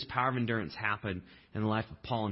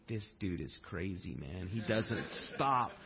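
exasperated mutter; 5 semitones lower, 6 dB quieter, slower than usual. Retold or imitated. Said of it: "Silas just thinking".

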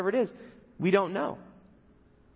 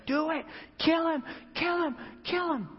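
much higher mock egging-on chant; men pressuring; 14 semitones higher than usual. David's men urging him to kill Saul, high mock chant. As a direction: egg him on like a rowdy crowd. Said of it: "his men were giving him the pressure".

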